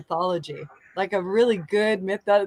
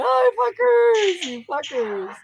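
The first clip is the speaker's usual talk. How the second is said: mock exclaim; 11 semitones higher, 4 dB louder, slower than usual. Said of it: "And I was like".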